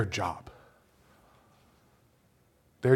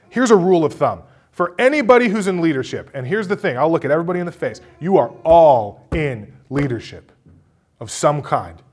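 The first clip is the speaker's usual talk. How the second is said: usual speaking voice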